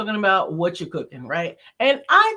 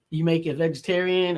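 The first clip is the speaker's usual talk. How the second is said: ordinary talk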